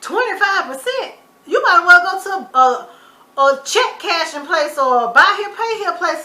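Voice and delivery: high, loud and slow protest; outraged disbelief. Retold or imitated. Reacting to the uncle's rate, outraged. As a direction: scoff at the loan terms. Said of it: "I said".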